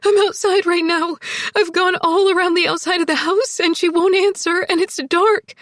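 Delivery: high, loud, panicked tearful report; sobbing while talking. A narrator's reading. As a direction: pour it out through sobs. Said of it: "sobbing and talking at the same time".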